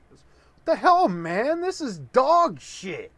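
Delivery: shocked, revolted yelp that slows on the last words; disgusted outburst. Acted. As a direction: spit it out like you just bit into something foul. Son tastes the joke chocolate.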